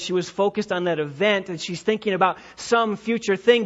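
usual speaking voice

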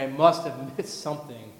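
low, soft, defeated muttering, fading away; discouraged giving up. Retold or imitated. Preacher voicing a disheartened Paul about to quit, quiet and defeated. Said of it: "throw in the towel there and say".

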